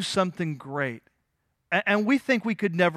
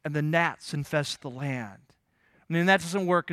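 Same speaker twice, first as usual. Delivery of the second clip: normal talking voice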